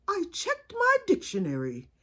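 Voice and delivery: high, carefully enunciated aunt; Aunt Ada. Retold or imitated. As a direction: imitate a proper aunt formally reporting her research. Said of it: "That's just how she talks".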